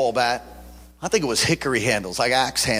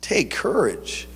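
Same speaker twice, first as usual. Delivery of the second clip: slow reassurance; 2 semitones higher, slower than usual. Real, not acted. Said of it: "repeats slowly".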